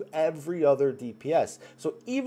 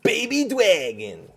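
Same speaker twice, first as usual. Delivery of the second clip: slow, higher mocking New York accent; Joey Wheeler impression; 7 semitones higher, 4 dB louder, much slower than usual. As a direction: stretch it out like a brash New York kid. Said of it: "I also like to kind of mimic him".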